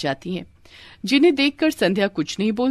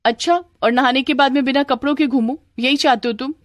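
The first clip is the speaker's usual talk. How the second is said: higher, cross challenge; sarcastic indignation; 6 semitones higher than usual. Acted. Wife to husband about her left bag, indignant. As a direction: challenge him in a raised pitch.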